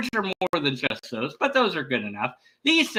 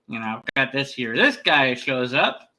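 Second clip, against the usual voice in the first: usual speaking voice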